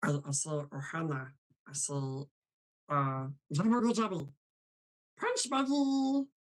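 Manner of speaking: garbled little alien creature voice; Stitch. Acted. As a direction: chatter like a small alien critter. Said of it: "uh, Stitch".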